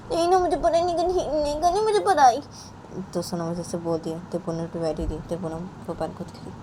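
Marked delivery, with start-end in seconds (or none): 0.1-2.4 put-on high, sulky child refusing; whiny refusal. Sonam refusing her mother, high and sulky. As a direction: whine that you won't study.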